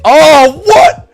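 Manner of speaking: sudden high shocked yelp; shocked what. Real, not acted. Streamer sees the poll result, shocked yelp. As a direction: jolt up in disbelief at the poll.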